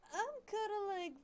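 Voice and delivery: smug big-daddy swagger, opening high; Tom Nook. Acted. Game boss bragging to the player, put-on swagger. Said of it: "That's Tom Nook to me right now".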